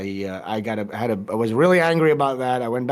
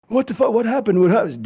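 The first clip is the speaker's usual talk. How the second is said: higher, startled imitation of Mike; mock alarm, pitched up; alarmed reply; 7 semitones higher than usual. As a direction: mimic a sudden panicked reply. Mike suddenly replies alarmed.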